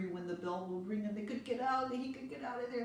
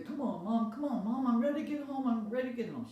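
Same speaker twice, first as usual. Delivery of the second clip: impatient whine; 7 semitones higher, 7 dB louder than usual. Acted. Boy whines to hurry.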